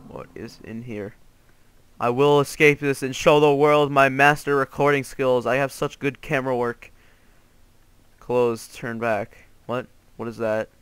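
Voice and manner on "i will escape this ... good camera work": loud, emphatic proclamation; mock-heroic boast. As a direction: declare it grandly to the world. Grand boast about escaping.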